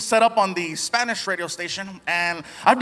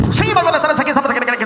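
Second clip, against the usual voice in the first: Mexican radio host; 5 semitones higher, 4 dB louder, much faster than usual. Retold or imitated. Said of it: "he's like".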